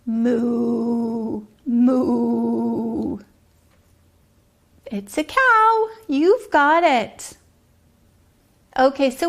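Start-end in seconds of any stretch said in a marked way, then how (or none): 0.0-3.2 lightly voiced cow; a mild cow moo for a child. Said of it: "And they say". 4.9-5.9 much higher, bright reveal; delighted cow answer to the child; excited reveal. Acted.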